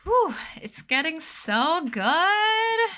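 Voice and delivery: high, slow, drawn-out thrilled whew; excited whew. Real, not acted. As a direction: let the excitement burst out. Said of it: "Are you guys in suspense?".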